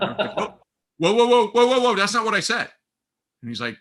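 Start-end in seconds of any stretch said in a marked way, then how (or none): 0.4-2.7 loud, high string of whoas; retold alarmed objection, high and loud; urgent interruption. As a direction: cut them off before they get it wrong. Retold urgent correction of caller's assumption.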